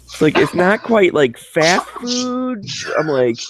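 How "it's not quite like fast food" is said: much higher, very slow sing-song hint; mock helpful customer service lady; hinting agent voice. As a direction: coax him like a patient helper.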